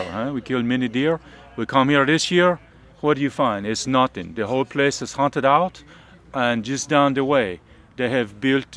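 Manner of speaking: put-on thick French accent, ending clauses on "huh?". Reenactor as French hunter grumbling about English settlers, French accent. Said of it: "doing my bad French accent".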